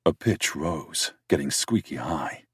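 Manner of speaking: plain reading voice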